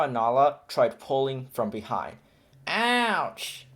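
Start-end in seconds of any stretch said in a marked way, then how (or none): 2.7-3.7 higher mock yelp of pain; baby elephant yelping. A narrator's reading. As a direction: cry out like a hurt calf. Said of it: "Bombo cried".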